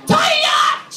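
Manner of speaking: high, loud bellow; imitated shrieking neighbour; wake-up yell. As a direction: holler it across the village. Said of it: "somebody woke me up by going".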